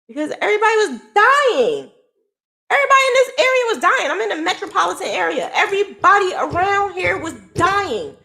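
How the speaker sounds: high-pitched, upset outpouring; distressed emphasis. Real, not acted. Woman explains everyone around her was dying, high and distressed. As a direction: voice climbing high, pressing on every word.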